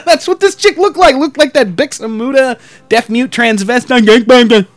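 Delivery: laughing, high and loud. Real, not acted. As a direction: laugh through the words. Laughing while telling.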